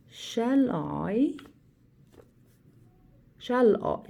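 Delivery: "Shall I" drawn out, rising on "I"; syllable stress demo. Shown as a demonstration. Teacher showing girls the sonnet's beat, "Shall I" stretched with stressed "I". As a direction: lean slowly on "I" after "Shall". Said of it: "stress a syllable".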